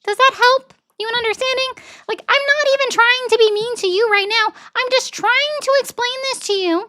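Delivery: put-on very high, childlike voice all through; high-pitched kid voice. Shown as a demonstration. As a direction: squeak it out like a little kid. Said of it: "actually talk like a kid, be high-pitched".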